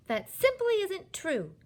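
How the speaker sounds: high, loud indignant protest; indignant child's outburst; angry cry. A narrator's reading. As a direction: contradict hotly, pitched right up. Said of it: "Sophie cried angrily".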